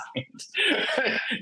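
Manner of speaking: a high burst of laughter. Real, not acted. Laughing at getting signed by luck, a high laugh.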